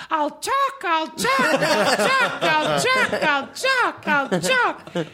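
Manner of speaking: very high squeaky pleading voice; desperate cracking pleading. Retold or imitated. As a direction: beg for work in a shrill high squeak. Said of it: "he's got a very high-pitched voice".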